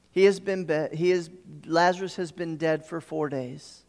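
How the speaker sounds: ordinary talk, nothing marked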